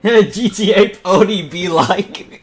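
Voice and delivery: loud, slow words pushed out through laughter; cracking up while reading the joke aloud; can barely get it out for laughing. Real, not acted.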